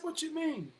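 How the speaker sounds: high-pitched puzzled question; acted-out disbelief, voice pitched high; incredulous retelling. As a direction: jump way up in pitch, baffled. Retelling own disbelieving reaction.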